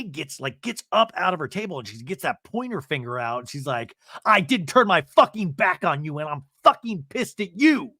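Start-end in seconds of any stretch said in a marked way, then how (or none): 4.3-7.9 loud retold tirade, punching the swear words; acted fury. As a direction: tell her off at full volume. Acted quarrel, finger pointed.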